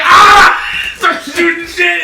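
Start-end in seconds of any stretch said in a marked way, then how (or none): 0.0-0.6 loud sudden yell; startled yell. Retold or imitated. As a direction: yell out in surprise. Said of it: "He's like".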